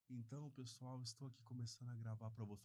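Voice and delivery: swallowed, barely-there words; talking inward. Retold or imitated. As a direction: mumble it shyly into yourself. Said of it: "falando pra dentro".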